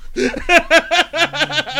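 big high burst of laughter; loud laugh. Real, not acted. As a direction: cackle out loud at the joke.

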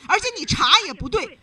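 forceful loud telling-off; loud rebuke. Real, not acted. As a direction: cut her off with a loud scolding. Host rebukes caller's snooping.

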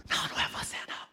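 unvoiced quiet reply; soft whisper. Jesus quietly answering Peter, whispered. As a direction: whisper back gently.

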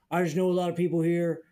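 a hint of an earnest old-timer; failed impression. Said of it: "Let me try that impression again".

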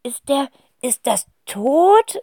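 high, slow whispered question; whispering boy. Acted. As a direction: whisper it in awe. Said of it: "wispert Zacharias". (hohes, langsames Flüstern)

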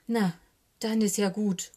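plain reading voice

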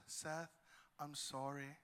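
hushed, low child's apology, acted; soft little apology. Three-year-old leans over to her brother, hushed apology. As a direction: say it small and quiet, imitating a sweet child.

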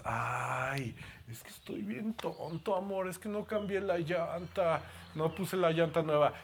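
fishing for comfort in a small pitiful voice; half-whispered self-pity, playing dumb; a mock-sheepish boyfriend begging to be reassured; whiny little voice. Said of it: "allá vas con vocecita así".

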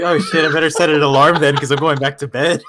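amused, laughing through the words. Retold or imitated. Telling friends his reaction to the late call, laughing. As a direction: let a laugh run through the retelling.

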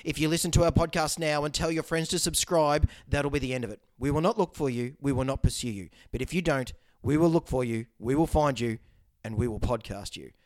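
put-on low, measured movie-threat monologue in own accent; deadpan menace, with falling line ends; Liam Neeson impression. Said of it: "You didn't have the voice".

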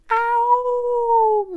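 high loud yelp of pain. Acted. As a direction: yelp as if burned. Wolf burns his bottom.

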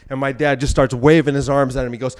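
ordinary talk, nothing marked

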